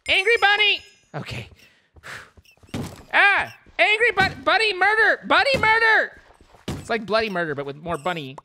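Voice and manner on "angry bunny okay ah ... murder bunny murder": very high, loud mock-horror cry; loud high-pitched panicked exclamation; panicked squeal. Real, not acted. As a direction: shriek it like a horror movie. Bunny attacks, panicked commentary.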